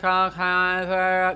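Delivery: a flat, uncaring okay with no inflection; bored okay. Retold or imitated. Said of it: "there's no inflection, there's no cadence, they could care less".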